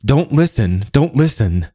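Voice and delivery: frightened woman pleading between quick breaths; breathless fear. A narrator's reading. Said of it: "breathing fast".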